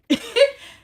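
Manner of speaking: high, loud burst of laughter; sudden laugh. Real, not acted. Breaking off her rant, a loud high laugh.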